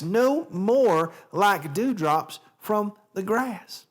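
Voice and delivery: theatrical Southern reading, lingering on the words; Southern accent. Shown as a demonstration. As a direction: declaim it with a drawn-out Southern drawl. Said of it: "a Southern accent".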